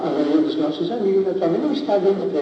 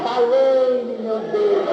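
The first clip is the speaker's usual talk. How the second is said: higher, half-acted cry to God; retold cry for help; 5 semitones higher, much slower than usual. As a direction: imitate a plea for mercy, moderately raised. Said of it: "Mas comecei a gritar".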